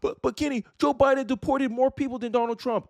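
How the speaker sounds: high, whiny mock protest; mocked objector. Mimics a commenter objecting to him.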